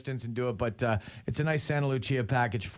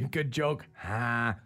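usual speaking voice

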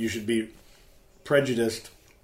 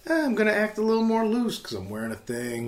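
loose party voice; 11 semitones higher, 5 dB louder than usual. Retold or imitated. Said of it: "all of a sudden you're like".

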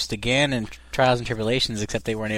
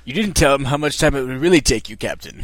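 Sulu impression; 2 semitones higher, 7 dB louder than usual. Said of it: "I can only do Sulu".

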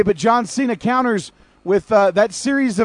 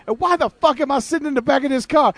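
higher, hammed-up indignant rant; mock angry movie quote; 7 semitones higher than usual. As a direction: bark it like the angry gangster. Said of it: "And he's like".

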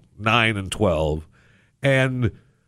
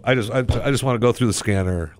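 ordinary talk

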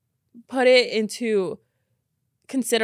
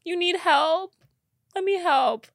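cooing; 7 semitones higher than usual. Retold or imitated. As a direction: coo like soothing a child. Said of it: "And I'm like".

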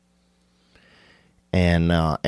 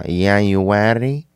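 put-on slow, drawn-out Mexican accent; much slower than usual. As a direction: playfully stretch it in a Mexican accent. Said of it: "to do a Mexican accent".

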